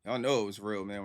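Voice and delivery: ordinary talk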